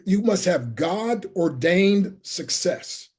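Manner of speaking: "you must have God-ordained success" said slowly, each word weighted; slow down and land "God-ordained success" as the big reveal; preacher's slow emphatic punchline; slow emphasis on the key phrase. Shown as a demonstration. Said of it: "and here's the word".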